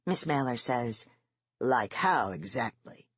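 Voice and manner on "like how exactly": slow, sneering ask; mocking, unfriendly ask; nasty question. A narrator's reading. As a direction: make the question a jab. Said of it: "Sergeant asks nastily".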